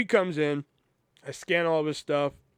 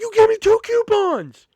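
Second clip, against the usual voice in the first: high, loud exasperated yell; losing it; 11 dB louder than usual. Retold or imitated. Cashier yelling back at the coupon customer, high loud outburst. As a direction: snap back at the customer, fed up. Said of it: "that's when I lost it".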